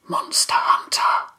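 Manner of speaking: whispered "Monster Hunter!" from the dark; a hoarse old creature hissing a name. Acted. Old Brindlebore calls Theo from the shadows, hoarse whisper. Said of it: "A hoarse voice came from the shadows".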